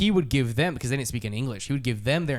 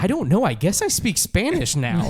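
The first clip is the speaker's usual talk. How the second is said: higher, louder, slowing on the second line; mock innocent surprise, pitched up; mock baffled shrug; 8 semitones higher, 5 dB louder than usual. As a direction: play it as a cheeky shrug. Retold shrug to dad.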